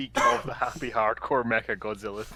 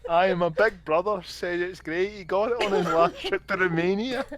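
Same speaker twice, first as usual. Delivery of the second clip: put-on Glasgow street accent; 5 semitones higher than usual. Continuing Ned voice.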